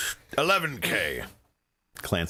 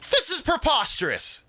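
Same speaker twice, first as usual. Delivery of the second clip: outraged; 4 dB louder than usual. Acted. Outraged rival at bid.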